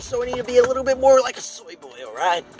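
hammy American vlogger, drawing out the sign-off high; fake American accent. Vlogger greets viewers back with bacon, fake American accent. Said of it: "still talking the American accent".

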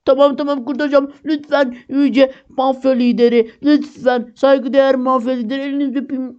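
mock grovelling. Player grovels before mafia leader.

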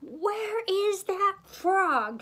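high, loud, drawn-out yell. A narrator's reading. Miss Piggy hunting for Kermit, yelling. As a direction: holler it slowly, stretching each word. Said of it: "she yelled".

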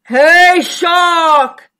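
loud, drawn-out booming shout; booming whale. A narrator's reading. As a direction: bellow as an enormous whale. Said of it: "boomed the whale".

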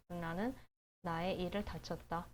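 usual speaking voice

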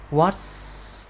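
"what's" drawn out into an s; final S sound. Shown as a demonstration. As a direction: isolate "what's" with a long hissed s ending. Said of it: "chữ này lại phát âm là S".

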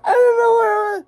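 breaking down mid-sentence, real tears; crying. Real, not acted. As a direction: voice cracks high into a sob. Breaks down over 9/11 memory.

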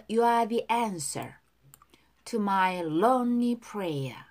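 slow, even teacher's model line; slow model reading. Shown as a demonstration. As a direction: spell each word out slowly. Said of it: "두 번씩 따라 할게요". (천천히 또박또박 시범 발음)

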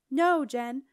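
loud, firm shout of refusal; yelled. A narrator's reading. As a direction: yell a firm no. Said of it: "Septimus yelled".

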